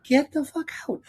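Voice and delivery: high, mock-outraged squeal; a comic high-pitched outburst; mock disbelief. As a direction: squeal it in pretend shock. Imagined retort to father.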